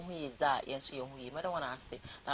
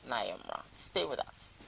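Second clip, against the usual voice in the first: ordinary talk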